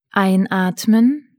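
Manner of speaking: calm guided breathing; soothing spa whisper. Acted. Guided relaxation, breathing cues.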